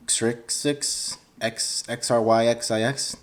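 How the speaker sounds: puzzled slow spelling. Real, not acted. Reading a weird username aloud, slow and puzzled. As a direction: sound it out letter by letter, slowly.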